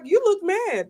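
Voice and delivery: high imitation of other people; a high mock "you look mad"; quoting others. Others remarking on her face, high mimicking voice. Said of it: "they're like".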